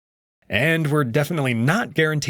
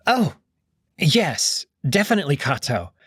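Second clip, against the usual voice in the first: high, hurried eager agreement; nervous Cassius; 4 semitones higher than usual. Acted. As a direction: jump in to agree, flustered.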